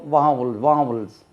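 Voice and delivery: वावल्स said as vaa-vals, open first vowel; model pronunciation. Shown as a demonstration. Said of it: "वो वॉवल्स नहीं होती".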